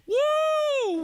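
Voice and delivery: high, loud mock cheer; hammed-up triumphant whoop; victory whoop. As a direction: crow over the win like a cocky soldier. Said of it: "looking around saying".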